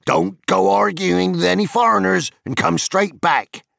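high, loud farm wife calling after him from the door. A narrator's reading. Wife calling from the open door to her husband, high and loud. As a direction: call him back from the doorway, up high and loud. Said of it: "she called".

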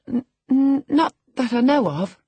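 high, loud, stammered denial; stammering. A narrator's reading. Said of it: "stammered Miss".

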